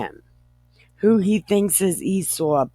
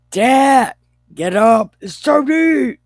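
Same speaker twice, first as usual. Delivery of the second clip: much higher, louder eager son's voice; eager son calling; 9 semitones higher, 9 dB louder than usual. Acted. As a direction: call out to wake up Dad, high and loud. Esau calling his father to eat.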